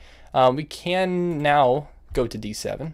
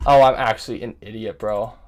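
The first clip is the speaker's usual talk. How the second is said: normal talking voice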